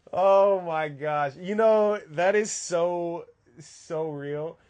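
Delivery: loud, drawn-out, amazed "oh my gosh"; excited outburst. Real, not acted. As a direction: burst out, stretching every word. Reacting to the brag question.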